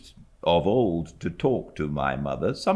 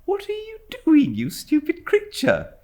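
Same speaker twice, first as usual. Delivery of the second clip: much higher, light teasing mother's voice; young mother. A narrator's reading. Mother teasing her servant, a high mock-scolding voice.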